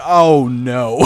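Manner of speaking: ordinary talk, nothing marked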